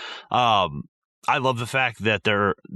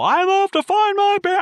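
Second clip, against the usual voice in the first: much higher, louder, squeaky cartoonish voice; silly movie quote; 5 dB louder than usual. Quoting a favourite small moment, squeaky and high. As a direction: squeal it like a silly little character.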